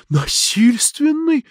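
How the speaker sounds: high, slow incredulous question; startled question. A narrator's reading. Lidia questions the doctor on a violent death, startled. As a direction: echo it in drawn-out shock. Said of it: "спросила Лидия".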